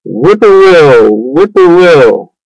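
slow, drawn-out imitation of a whippoorwill; bird call. A narrator's reading. Bird singing from across the road, slow imitated call. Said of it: "a bird sang".

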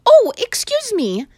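startled apology; surprised maid. Acted. Maid bursting in on the boy, startled.

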